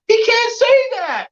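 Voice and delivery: very high, drawn-out mock holler; squawked high protest, slow and stretched; retold yell. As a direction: imitate an outraged fighter yelling in protest. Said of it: "Tank's yelling out".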